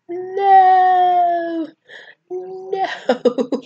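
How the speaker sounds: long, high, loud drawn-out no; panicked mock yell. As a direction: wail it as if stopping someone far away. Friends calling to boy on fence.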